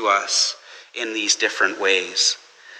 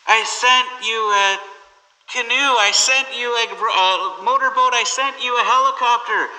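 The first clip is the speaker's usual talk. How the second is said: much higher, mock-exasperated God; lightly voiced God, fed up; God replying; 9 semitones higher than usual. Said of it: "God responds from heaven and says".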